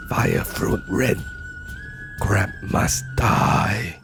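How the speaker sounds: slow, grunting caveman voice; guttural caveman grunt. Acted. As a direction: grunt each word like a caveman. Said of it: "Kruk grunts gutturally".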